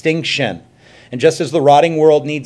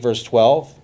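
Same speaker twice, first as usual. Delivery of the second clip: ordinary talk, nothing marked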